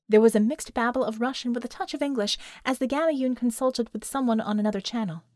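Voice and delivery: plain reading voice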